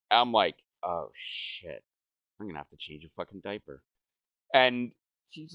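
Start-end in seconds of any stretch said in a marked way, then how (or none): 0.8-3.8 low, much softer, rushed mutter; quiet, fast, doomed mutter; muttered dread. Retold or imitated. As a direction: dread the diaper under your breath. Dread of diaper duty.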